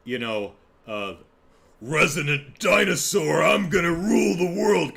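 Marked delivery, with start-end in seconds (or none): 1.8-5.0 loud, raised, roaring monster villain; hammed-up resonant dinosaur roar; Bowser. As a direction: bellow it like a huge boastful beast. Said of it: "Bowser has the".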